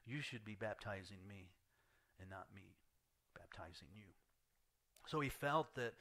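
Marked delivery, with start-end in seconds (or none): 0.1-4.1 softer and lower, dying away; fading plea. Retold or imitated. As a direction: let it sink to almost nothing. John humbled, fading.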